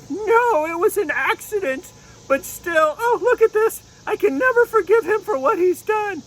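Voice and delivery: very high, indignant unicorn refusing; little unicorn outraged over her mane; upset unicorn puppet. Pretend. Unicorn puppet refusing the dragon, very high and upset. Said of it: "she said".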